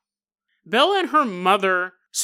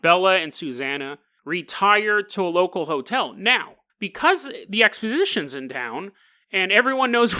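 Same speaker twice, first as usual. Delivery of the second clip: normal talking voice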